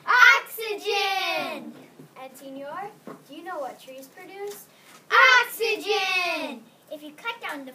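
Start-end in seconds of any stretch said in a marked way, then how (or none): 0.1-1.7 long loud slow cry; loud stretched exclamation; loud drawn-out shout. Acted. Creature exclaims answer. 5.4-6.6 long loud slow cry; loud stretched exclamation; loud drawn-out shout. Acted. Creature exclaims answer.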